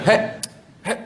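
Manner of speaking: short wordless huffs, as if shooing a cat; imitated shooing grunts. Son shows how his father calls him, curt shooing grunts. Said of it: "dia panggil macam halau kucing je".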